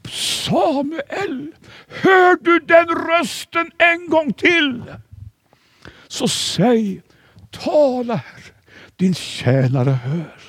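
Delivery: put-on high, slow, tense old man's voice; old priest's urgent instruction. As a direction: press the boy with trembling urgency. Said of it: "och sa".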